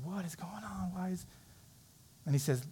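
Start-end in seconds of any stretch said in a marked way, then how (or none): 0.0-1.2 whispered as confused students. Retold or imitated. Puzzled students whispering to each other. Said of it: "they're, like, confused".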